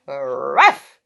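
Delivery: low loud woof; dog bark. Acted.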